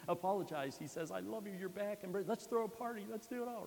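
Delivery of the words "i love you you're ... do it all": joyful father. Acted. Father welcomes returning son.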